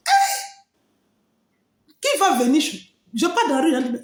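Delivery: usual speaking voice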